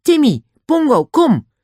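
very high, loud summons; shouting. A narrator's reading. Julian calls Timmy and Pongo, shouting urgently. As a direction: call the animals over at full voice. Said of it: "schreeuwde Julian".

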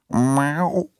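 high, mewing cat meow. A narrator's reading. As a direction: meow like an old cat answering. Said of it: "Ze maakte een klein mauwerig geluidje". (hoog, miauwend kattengeluid)

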